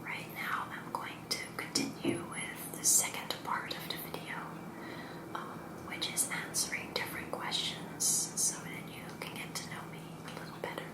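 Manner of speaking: hushed, unvoiced-breath whisper to the viewer; ASMR whisper. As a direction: whisper it softly, close and intimate. Whispered first part of video.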